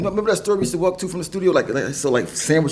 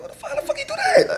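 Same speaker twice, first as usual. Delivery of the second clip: much higher, laughing mock outrage; stunned disbelief; faster than usual. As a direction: squeal it out in disbelief. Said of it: "But I'm like".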